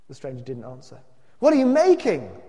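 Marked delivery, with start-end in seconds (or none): 1.4-2.4 loud, high demanding question; raised-voice cry from the crowd; a bit louder. A narrator's reading. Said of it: "a bit louder".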